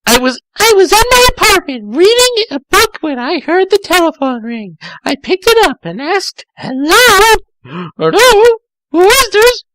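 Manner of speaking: put-on very high, loud girly voice; high girly Amy. Reader voicing Amy answering the phone, high girly voice. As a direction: squeak it out as a bright young girl.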